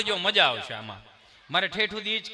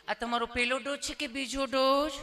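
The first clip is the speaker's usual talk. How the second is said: lightly voiced gentle nurse, higher; sweet nurse; 4 semitones higher than usual. Nurse gently asking the patient which dose. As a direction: ask kindly like a polite nurse. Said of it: "nurse બેને એવું પૂછ્યું".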